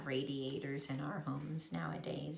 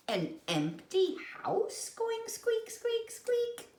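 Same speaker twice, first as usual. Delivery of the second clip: high squeaks; 3 dB louder than usual. A narrator's reading. Imitating squeak.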